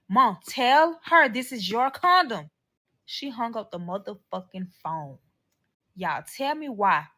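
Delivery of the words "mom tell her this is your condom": loud, slow, high-pitched appeal; drawn-out retold plea; pleading demand. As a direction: imitate him urging his mom to back him up. He begs his mother for backup.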